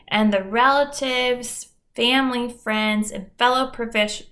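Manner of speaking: normal talking voice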